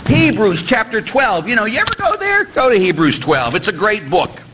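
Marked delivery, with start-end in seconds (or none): none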